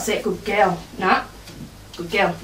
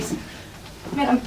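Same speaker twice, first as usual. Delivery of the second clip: whispered coaxing; 11 dB quieter, much slower than usual. Real, not acted. Coaxing dog to be picked up.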